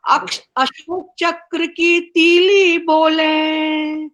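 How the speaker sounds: measured, sing-song recital with each line repeated; poem recitation. Acted. Poet reciting her tricolour poem to the online gathering, rhythmic recital. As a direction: lift the refrain like a patriotic anthem.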